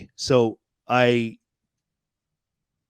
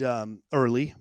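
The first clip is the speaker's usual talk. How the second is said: ordinary delivery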